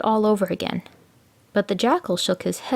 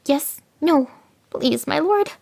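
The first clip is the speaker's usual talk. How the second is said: flustered jackal; 8 semitones higher, slower than usual. A narrator's reading. Jackal fakes confusion.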